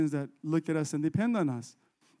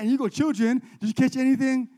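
indignant mocking quote-back; hammed-up affront; offended; 6 semitones higher, 4 dB louder than usual. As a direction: throw the question back, high-pitched. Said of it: "You'd be all offended".

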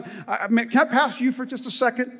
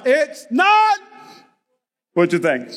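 parents' exasperated call; 3 semitones higher, slower than usual. Retold or imitated. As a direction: sigh it like tired parents. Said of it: "Parents in the house".

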